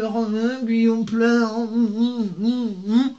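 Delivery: high, slow fake whimpering over Guillaume; mock crying fan. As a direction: sob it out like a gushing fan, hammed-up. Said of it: "qui sont pas complètement gaga de".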